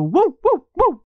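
high yappy woof woof; dog barking. A narrator's reading. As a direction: bark it like a small dog. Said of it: "Então o cão latiu".

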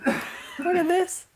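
high, slow, exasperated look at this. Retold or imitated. Overwhelmed mother facing chaos, slow exasperated cry. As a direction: sigh it out at the chaos. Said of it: "you almost go".

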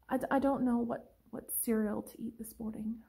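soft, hesitant mock helplessness; timid indecision. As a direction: play a meek girl who can't decide. Acting out indecisive girl at breakfast.

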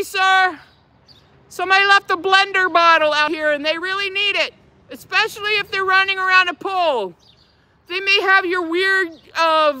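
high-pitched mock public appeal; mock urgent complainer. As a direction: call out in pretend concern for a stranger. Mock public call over a left bottle.

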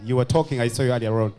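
ordinary delivery

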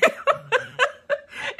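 sudden high laugh; laughing at her own age. Real, not acted. Laughs at aging herself.

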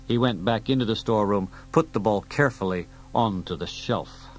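usual speaking voice

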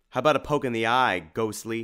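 fast, loud jeering challenge; cocky battle taunt; defiant taunt. Acted. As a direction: swing the words like a sword. Tim taunts the monster.

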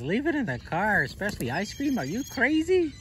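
high squeaky seagull voice; squeaky cartoon voice. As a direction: squeak out a silly bird. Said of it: "Mister Seagull's laughing at that. He goes".

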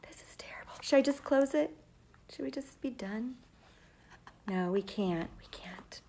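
hushed unvoiced whispering to the class; teacher pretending the story is too sad, whispered; mock whisper.

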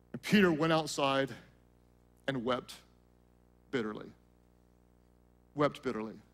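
low, hushed telling with long pauses; solemn scripture narration. A narrator's reading. Preacher telling of Peter's bitter weeping, hushed and solemn. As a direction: let each phrase sink in, softly and gravely.

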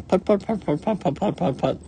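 high, rapid engine puts; toy car putting. A narrator's reading. Little rabbit in the play car, high put-puts. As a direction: sputter like a little motor. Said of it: "in the play car is saying".

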